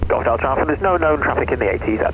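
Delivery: fast radio-filtered controller voice; crisp controller reply through radio static; pilot over the radio. Real, not acted.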